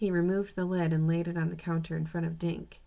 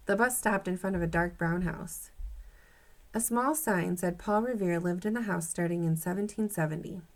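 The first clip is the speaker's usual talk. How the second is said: ordinary delivery, nothing marked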